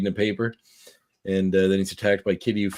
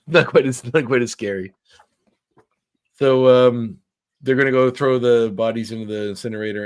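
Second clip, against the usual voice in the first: ordinary talk, nothing marked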